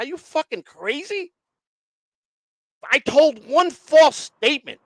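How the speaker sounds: retold angry protest, slowed and pushed; indignant outburst. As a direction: hammer home the injustice. Angry retort to prosecutors.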